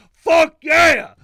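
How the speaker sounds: loud, high yell of triumph; fist-pumping victory shout; triumphant shout. Retold or imitated. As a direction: cheer it out at full volume. Reliving his fist-pumping cheer at the documentary.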